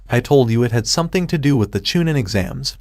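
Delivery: ordinary delivery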